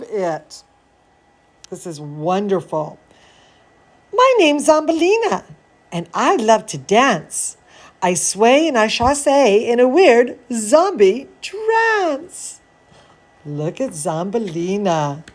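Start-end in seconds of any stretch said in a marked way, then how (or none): 4.1-12.6 lively storybook read, a bit higher; reading to children. A narrator's reading. Reading Zambolina to kids, lively storybook voice. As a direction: read it like a bedtime story for little ones. Reading picture book aloud.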